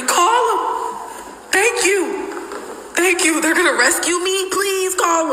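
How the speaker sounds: high, squeaky pleading mimic; mocking his panic. Mocking the boyfriend begging for security, high squeaky plea. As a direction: beg like a scared man, mocking him. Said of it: "I'm about to call security".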